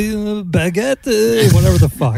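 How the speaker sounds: hammed-up French accent, pitched slightly up; mock French guy. French guy excusing himself to the woman who refused him, mock French accent. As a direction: trail off into mock French filler words. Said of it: "the French guy pulled me back".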